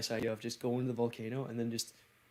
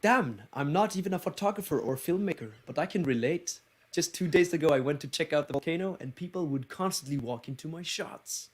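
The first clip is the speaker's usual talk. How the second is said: higher-pitched Icelandic accent; 5 semitones higher than usual. Shown as a demonstration. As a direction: read it out in a lively Icelandic accent. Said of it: "Icelandic accent, please".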